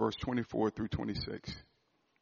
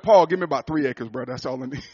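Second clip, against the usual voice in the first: playful ask; 13 dB louder than usual. Pretend. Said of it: "to be like".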